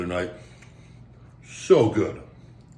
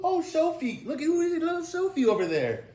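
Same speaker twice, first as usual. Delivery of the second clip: a gushing high coo for Sophie; cooing to dog. Real, not acted.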